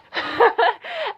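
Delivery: high, squealing laugh; giddy laugh. Real, not acted. Recalling the moment, she bursts into a high giddy laugh. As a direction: laugh out the embarrassment.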